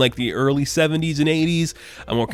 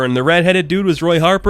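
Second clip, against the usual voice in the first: usual speaking voice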